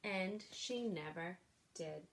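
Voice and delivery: put-on lively read-aloud for children; storybook reading. A narrator's reading. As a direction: read it like a bedtime picture book. Reads a picture book aloud.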